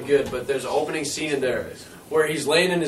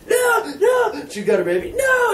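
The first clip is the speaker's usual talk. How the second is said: shrieked high panicked no; mock panicked scream; slower than usual. Retelling a movie to friends, he voices the screaming mother in high panic. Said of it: "the girl's like".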